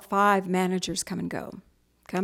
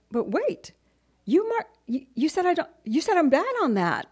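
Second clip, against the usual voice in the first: indignant protest; 8 semitones higher than usual. Retold or imitated. Acted argument with the manager.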